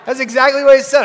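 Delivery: loud, half-laughing; can't believe it, almost laughing; laughing disbelief. Real, not acted. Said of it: "I'm trying not to laugh".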